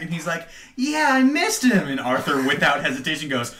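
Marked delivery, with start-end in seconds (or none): 0.7-1.9 put-on very high, slow child voice; imitated little boy. Retelling Jack's answer to Arthur at camp, imitated little boy. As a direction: play a small kid, pitched way up.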